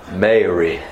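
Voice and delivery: low Southern drawl on the name; Southern accent. Shown as a demonstration. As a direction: say it as Southern as you can. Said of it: "say it as southern as I can".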